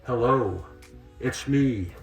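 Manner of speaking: ordinary talk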